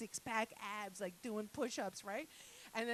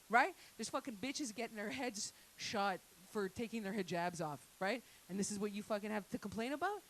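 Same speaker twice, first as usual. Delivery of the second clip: ordinary talk, nothing marked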